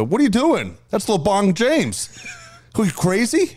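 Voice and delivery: high-pitched indignant protest, imitating; mock outrage. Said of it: "he'd get so mad".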